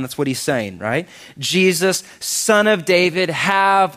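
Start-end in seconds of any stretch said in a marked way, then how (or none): 1.4-4.0 raised, higher pitched call. Acted. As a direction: call it out to the passing healer. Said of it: "He called out".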